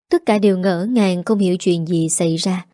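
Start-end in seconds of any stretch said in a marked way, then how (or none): none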